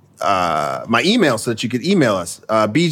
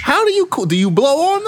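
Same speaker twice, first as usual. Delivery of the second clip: high squeaky voice. Acted. Incredulous silly questions.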